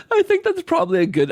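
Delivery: amused. Real, not acted. Host amused, starting a reply.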